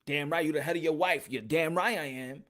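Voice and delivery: ordinary delivery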